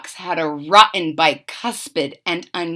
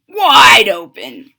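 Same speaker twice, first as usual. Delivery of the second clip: loud, slow command; wife yelling again; yelled; 10 dB louder, slower than usual. Acted. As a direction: stretch it out loud. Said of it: "Missus DeSoto said".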